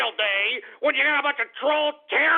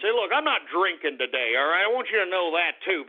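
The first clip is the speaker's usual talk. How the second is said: usual speaking voice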